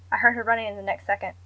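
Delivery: ordinary delivery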